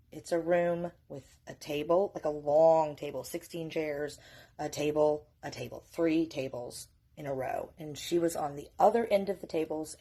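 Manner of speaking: ordinary talk, nothing marked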